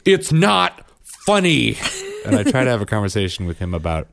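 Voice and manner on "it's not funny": put-on high, drawn-out indignant kid yelp; hammed-up imitation of an upset child; Bryn. Son who fell and got laughed at, mock indignant. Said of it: "And then Bryn goes".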